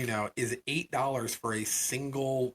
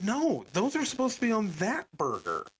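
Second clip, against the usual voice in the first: exasperated correction; 8 semitones higher than usual. Retold or imitated. Customer corrects wrong order again, retold.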